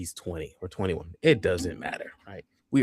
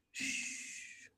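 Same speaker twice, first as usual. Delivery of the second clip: whispered shush; 18 dB quieter than usual. Retold or imitated. Hushing scared friend in bed.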